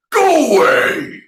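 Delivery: huge, high roaring command; thundering dragon. Acted. Dragon shouting at the tiger, thundering roar. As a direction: bellow it like a dragon driving off a tiger. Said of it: "Dragon thundered".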